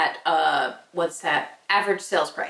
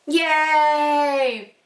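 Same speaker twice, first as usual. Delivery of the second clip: excited cheer; 6 semitones higher, 4 dB louder than usual. Real, not acted. Cheering a sale.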